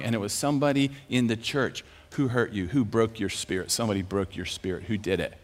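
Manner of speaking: low, soft, sincere questioning; gentle probing. Retold or imitated. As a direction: coax out an old hurt. Gently probing the man's hurt.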